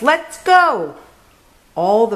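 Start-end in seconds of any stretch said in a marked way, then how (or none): 0.0-1.0 high, bright call to set off; rally the search party; cheerful shout. Acted. Badger lights a lamp, shouting to the friends. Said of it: "he shouts".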